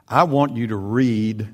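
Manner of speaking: usual speaking voice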